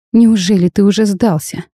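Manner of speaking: plain reading voice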